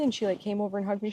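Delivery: normal talking voice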